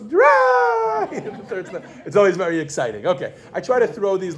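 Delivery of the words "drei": Drei! squealed very high, into a laugh; excited child squeal. Retold or imitated. Mother imitating toddler at the third snap, squealed Drei!. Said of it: "and she goes".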